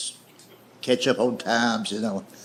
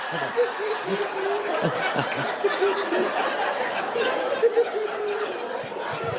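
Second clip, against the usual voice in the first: high cackling laugh; 4 dB quieter than usual. Real, not acted.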